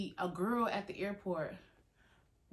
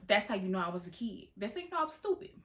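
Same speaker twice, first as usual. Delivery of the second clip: ordinary delivery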